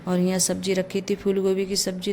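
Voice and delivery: usual speaking voice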